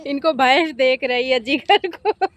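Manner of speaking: amused, laughing as she talks; real laughing. Real, not acted. Amused that the buffalo is watching Jigar, laughing. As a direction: say it through a laugh.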